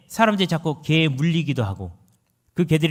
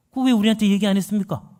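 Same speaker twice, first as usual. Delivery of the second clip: higher, louder, fast indignant protest; indignant surprise; 6 semitones higher, 5 dB louder, faster than usual. Retold or imitated. Natives protesting to the missionary, louder, fast and indignant. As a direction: blurt it out, offended they weren't told.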